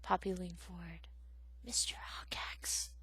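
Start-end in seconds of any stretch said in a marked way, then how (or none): 1.6-2.9 soft, slow unvoiced whisper. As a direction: whisper the feared name. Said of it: "she whispered".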